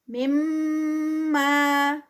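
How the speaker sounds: مما with ghunna, then a two-count ما; tajweed recitation. Shown as a demonstration. Teacher models مما for students. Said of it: "غنہ اس کا آئے گا".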